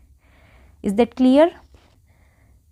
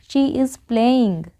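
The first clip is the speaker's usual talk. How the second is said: very slow, word by word; slow model sentence; much slower than usual. Shown as a demonstration. Teacher modelling an English sentence for Hindi learners, very slow. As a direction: say it slowly so learners can follow. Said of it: "तो क्या बोलेंगे".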